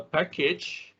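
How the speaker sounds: usual speaking voice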